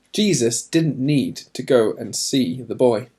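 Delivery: ordinary delivery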